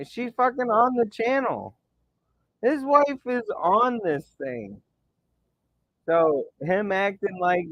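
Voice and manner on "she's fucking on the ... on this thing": pitched way up in disbelief; raised pitch. Real, not acted. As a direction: voice climbing as he rants. Rant about a rival.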